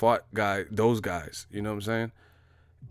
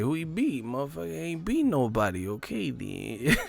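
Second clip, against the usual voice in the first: Roger Mayweather; 4 semitones higher, 4 dB quieter than usual. Retold or imitated. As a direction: imitate a blunt trainer brushing someone off. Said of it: "Roger Mayweather voice".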